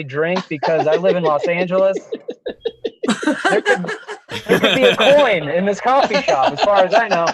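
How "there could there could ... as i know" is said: laughing. Real, not acted. Laughing at himself.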